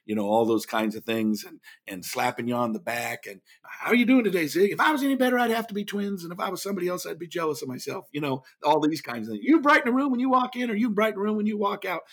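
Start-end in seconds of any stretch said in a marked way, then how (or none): 3.6-8.1 high-pitched, dynamic glad-hander imitation; Zig Ziglar. Speaker slapping backs and greeting everyone, imitated high dynamic patter. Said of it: "Zig was a dynamic". 9.4-12.0 high, always-on motivator imitation; Zig Ziglar. Motivator working the room, imitated high always-on patter. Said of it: "He was just always on".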